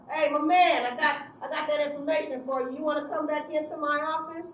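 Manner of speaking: loud, overly chummy phone call; fake friendly. Counselor calling the youth back to her office, loud and chummy. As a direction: sweeten him up loudly to lure him in.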